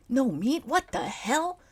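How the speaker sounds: high, loud indignant outburst; disgusted character; outraged. Acted. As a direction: blurt it out in outrage. Max outraged about vegan food.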